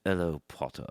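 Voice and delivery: lightly voiced sinister schoolmaster; quiet, drawn greeting from a stern professor; Professor Snape. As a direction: do a slight Snape impression. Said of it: "Professor Snape".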